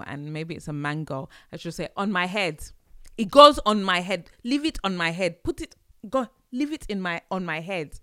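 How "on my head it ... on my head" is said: loud, higher-pitched mock exasperation; sarcastic mum. Mum answering her child's silly question, loud sarcasm. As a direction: imitate a sarcastic Nigerian mum, voice raised. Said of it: "It's their form of sarcasm".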